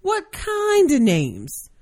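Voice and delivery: high, slow, falsely sweet question; gentle. A narrator's reading. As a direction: ask it with restrained, drawn-out politeness. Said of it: "and gently say".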